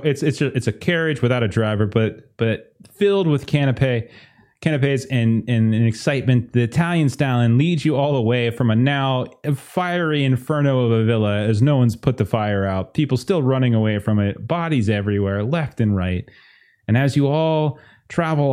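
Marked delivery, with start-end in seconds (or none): none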